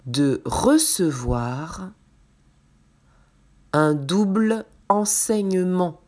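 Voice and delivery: slow, clear dictation voice; dictating. Acted. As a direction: read it out slowly word by word. Dictation read to the class.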